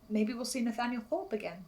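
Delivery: ordinary delivery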